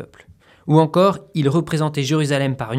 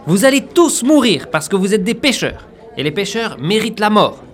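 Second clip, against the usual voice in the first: loud, high doomsday proclaiming; unhinged villain; 6 semitones higher, 5 dB louder than usual. Acted. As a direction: rant the threat like a madman. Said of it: "c'est pas la peine de le dire comme ça".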